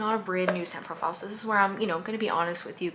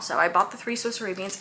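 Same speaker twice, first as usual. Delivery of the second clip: normal talking voice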